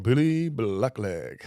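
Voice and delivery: slow, stretched sing-song call; imitated workmate's long-drawn taunt; drawn-out teasing nickname. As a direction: draw each word out mockingly. Retold nickname chant in anecdote.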